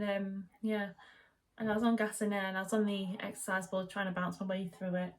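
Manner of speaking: usual speaking voice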